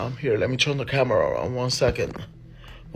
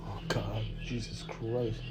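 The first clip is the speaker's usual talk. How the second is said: breathless scared whisper; frightened whisper; 10 dB quieter, slower than usual. Real, not acted. Vlogger hiding from the presence, scared whisper. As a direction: whisper it so nothing hears.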